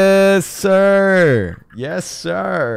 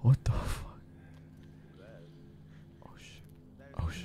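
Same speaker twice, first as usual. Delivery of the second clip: hushed; 8 semitones lower, 20 dB quieter than usual. Real, not acted. Sneaking past unknown people.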